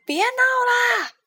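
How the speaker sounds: high, loud, slow yell; a sharp, drawn-out shout. A narrator's reading. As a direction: yell at him to stop. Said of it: "艾美丽大叫".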